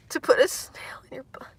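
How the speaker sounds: hushed shocked whisper; whispered disbelief. Real, not acted. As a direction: whisper it like it's scandalous. Reading shocking comment.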